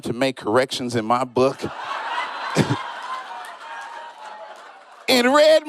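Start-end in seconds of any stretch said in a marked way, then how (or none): 1.7-4.0 airless squeaky laugh; cracking up silently, high; breathless high laugh. Real, not acted.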